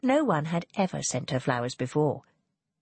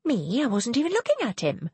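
higher-pitched denial; protesting; 7 semitones higher than usual. A narrator's reading. Daughter denies ogling the lord, higher protesting voice. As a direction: protest innocence, voice lifted. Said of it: "Ianthe protested".

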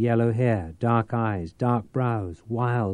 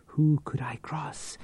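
a defensive, higher whisper; whispered protest; 5 semitones higher, 4 dB quieter than usual. Acted. Tate denies a double cross in a whisper. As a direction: whisper the denial nervously.

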